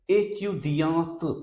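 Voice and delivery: "A Q D A T" as "ay-tyu-dyahnt", T released at the end; final T sounded. Shown as a demonstration. Teacher gives girl student form, "A Q D A T" with final T. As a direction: say "A Q D A T" ending on an audible T. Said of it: "this T will be pronounced".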